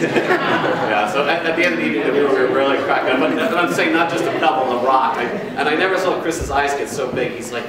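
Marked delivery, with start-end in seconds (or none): 0.0-5.2 laughing, amused. Real, not acted. Cracking up recalling the prank.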